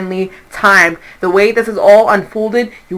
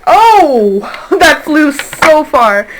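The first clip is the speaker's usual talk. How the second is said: loud startled oh, then laughing slowly; surprised laugh; 7 semitones higher, 4 dB louder, much slower than usual. Real, not acted. Reader startled as a card flies away, laughing. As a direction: caught off guard and laughing at it.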